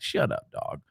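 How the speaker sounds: whispered brush-off; muttered comeback. Retold or imitated. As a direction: shoot it down under your breath. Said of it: "I'm like".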